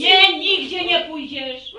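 loud, slow, firm refusal; stern forbidding. Acted. Mother forbids her daughter to leave, loud and slow. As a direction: lay down the law word by word.

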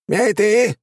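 high sharp shout. A narrator's reading. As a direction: bark it out at the prisoner. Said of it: "крикнул Биба".

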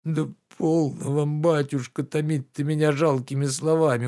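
mild whining entreaty; pleading. Acted. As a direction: plead with the master to stop. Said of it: "умолял Захар".

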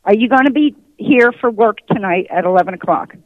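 normal talking voice

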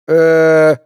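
drawn-out, level stalling sound; hesitant. A narrator's reading. Youth stumped by Gard's question, hesitant. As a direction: stall on a long, held hesitation. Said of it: "паренёк замялся".

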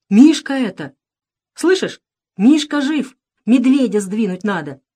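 high, loud, urgent cries; excited shouting. A narrator's reading. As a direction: burst out with urgent news. Boy shouts excited news to friend. (взволнованно кричит)

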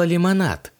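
plain reading voice

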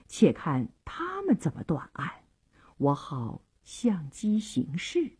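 hushed, low scheming thought; thinking to oneself. A narrator's reading. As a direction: murmur a plan privately. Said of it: "但又转念想到".

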